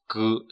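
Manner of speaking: the letter "g" as a hard g sound, as in "go". Shown as a demonstration. Teacher showing which sound replaces k, "g" said as hard g. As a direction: sound out "g" as a voiced hard consonant. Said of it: "我们读的时候要读成g".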